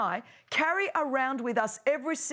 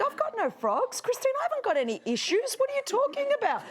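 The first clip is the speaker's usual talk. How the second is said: mock defensive denial; 9 semitones higher than usual. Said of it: "And we think".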